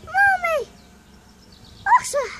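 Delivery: put-on high, loud child's call of "Mommy". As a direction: shout "Mommy! Oscar!" across the yard. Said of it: "Oscar say".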